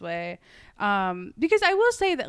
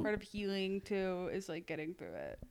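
trailing off; 9 dB quieter than usual. Real, not acted. Reflective point fading out.

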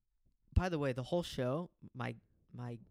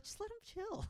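much higher, soft pleading; imitating friend; 8 dB quieter than usual. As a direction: coax them to relax. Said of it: "you're like".

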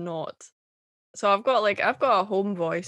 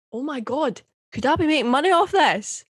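higher, loud burst of gleeful disbelief; mock amazement; 6 semitones higher, 6 dB louder than usual. Artist reacting to being accused of being paid, mock amazement. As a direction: exclaim it as if suddenly struck by the idea.

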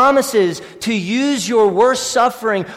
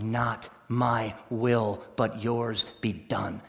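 very slow, lower and softer "Not my will, but yours be done."; hushed heartfelt prayer; 10 semitones lower, 12 dB quieter, slower than usual. Shown as a demonstration. Pastor modelling the heartfelt prayer, hushed and slow. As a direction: breathe the prayer quietly. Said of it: "to pray with all your heart".

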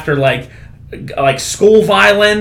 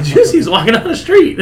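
higher, playful mock narration; storyteller voice; 11 semitones higher than usual. Friend retelling a comedy bit narrates the comedian's tale in a higher playful voice. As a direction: narrate the tale in a bouncy pitched-up voice. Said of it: "He was like".

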